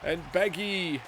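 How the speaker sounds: high, loud, breathless wrestling commentary; excited play-by-play. Acted. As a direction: call the match like every move is a shock.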